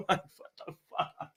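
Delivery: ordinary talk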